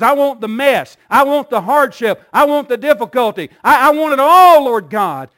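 fervent preacher's prayer swelling to a shout; impassioned plea. Acted. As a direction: plead harder with each phrase, peaking on ALL. Preacher voices a fervent plea to Jesus.

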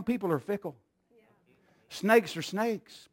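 ordinary talk, nothing marked

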